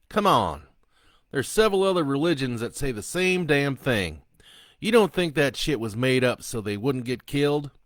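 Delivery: loud, high, sneering convict; defiant killer taunting. Acted. Killer Sloan taunting the priest, loud and sneering.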